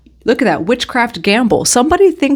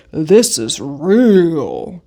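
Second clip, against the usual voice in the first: very slow, sarcastic lower delivery; 4 semitones lower, much slower than usual. Retold or imitated. Tarot reader mimicking [name]'s lyric, slow and sarcastic. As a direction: drag it out mockingly, lower, like you don't buy it. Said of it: "she says very sarcastically".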